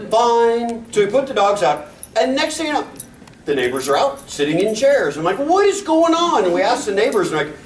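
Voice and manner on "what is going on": baffled. Retold or imitated. Baffled by neighbors in chairs.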